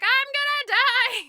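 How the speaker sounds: high, drawn-out mock wail; hammed-up cry of doom; acted scream. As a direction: wail it loudly, stretching the words. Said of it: "and she screams".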